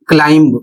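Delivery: क्लाइंब with the b sounded at the end; wrong pronunciation. Shown as a demonstration. Teacher mimics the common wrong way of saying climb. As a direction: say क्लाइंब as "klaimb". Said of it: "गलत है".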